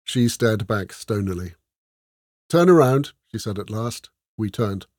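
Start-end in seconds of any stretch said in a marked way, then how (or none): 2.5-3.1 curt command. Acted. Curt order to the crew.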